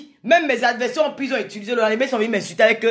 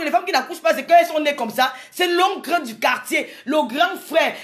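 ordinary talk, nothing marked

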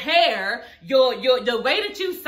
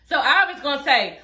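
ordinary talk

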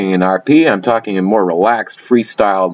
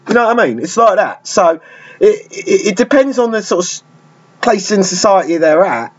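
South London street accent; 10 semitones higher than usual. Shown as a demonstration. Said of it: "I'm in a generic South London accent".

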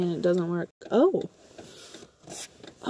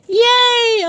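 high, loud yay; excited cheer; 12 semitones higher, 7 dB louder than usual. Real, not acted. Cheering at sighting of tow truck.

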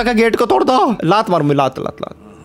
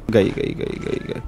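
hushed unvoiced whisper; 8 semitones lower, 8 dB quieter, slower than usual. Real, not acted. Gamer sneaking past mom in the game, whispering. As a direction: breathe the words so mom won't hear.

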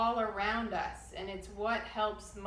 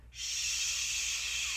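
a full, forceful hushing shh; loud shh. Shown as a demonstration. Teacher demonstrates the loud wood breath, strong shh. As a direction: shush hard, like silencing a crowd. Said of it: "really loud".

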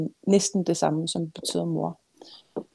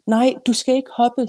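higher, louder storyteller's cry; mild narrated scream; 6 semitones higher, 6 dB louder than usual. A narrator's reading. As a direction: cry out in alarm, restrained. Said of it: "skreg Anna".

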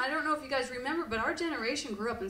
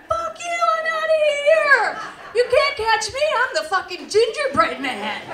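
much higher, louder bratty taunt; cocky gingerbread man; 13 semitones higher, 10 dB louder than usual. Acted. Gingerbread man escaping the oven, high bratty taunt. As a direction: taunt like a runaway cookie.

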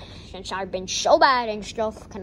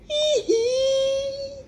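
high wail; 12 semitones higher than usual. Acted. Crying character.

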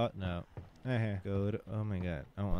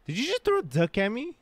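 surprised outburst; 12 semitones higher, 7 dB louder than usual. Real, not acted. Startled by thrown duck.

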